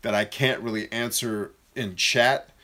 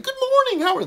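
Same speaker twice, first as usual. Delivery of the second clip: squeaky, sky-high mock cheerful voice; chipper morning greeting; 6 dB louder than usual. Mocking chipper people's morning greeting, exaggeratedly high and perky. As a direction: voice shooting up octaves, over-chirpy. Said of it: "their voice goes up nine octaves".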